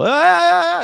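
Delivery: high, loud mock wail of a prayer call. Mocking the call to prayer, a high loud wail.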